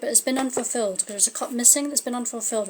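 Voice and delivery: usual speaking voice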